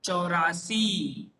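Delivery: चौरासी said slowly, each syllable clear; teacher modelling चौरासी slowly; slow pronunciation demo. Shown as a demonstration. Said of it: "तो उच्चारण कैसे होगा?".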